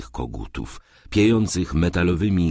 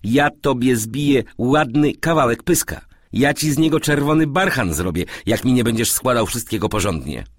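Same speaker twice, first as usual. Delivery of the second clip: high, loud threatening rant; scolding; 7 semitones higher, 4 dB louder than usual. A narrator's reading. Man berates his servant over scattered clothes, scolding. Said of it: "wymyślał Mateuszowi".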